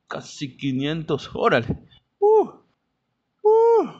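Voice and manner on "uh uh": shocked. Real, not acted. Shocked at the ticket price.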